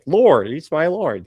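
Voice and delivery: mock pious. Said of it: "of course he would say".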